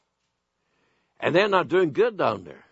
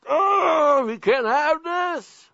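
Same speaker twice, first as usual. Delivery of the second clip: much higher, slow mocking voice of the leaders; mock outraged leaders; 11 semitones higher, much slower than usual. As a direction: parody the alarmed leaders, pitched way up. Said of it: "now are going".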